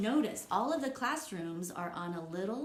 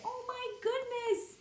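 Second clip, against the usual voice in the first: 1 amazed. Real, not acted. Teacher marvels at pop-up picture.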